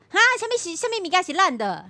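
put-on high, loud old granny calling out; grandma shouting. Grandma in the queue shouting at the vendor, high and loud. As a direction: belt it out like a nosy grandma backing you up. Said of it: "大声公帮我喊出来".

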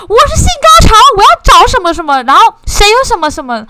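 high, loud shouted announcement; shouting out loud. Retold or imitated. Teacher tells the class to shout it. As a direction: yell it out to the whole room. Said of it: "一定要大喊说".

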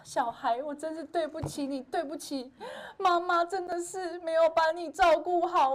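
high, mock tearful self-pity; melodramatic apology. Mother mocking the tearful guilt act, high and hammed-up. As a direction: ham up a soap-opera mother. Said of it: "很苦情的演说".

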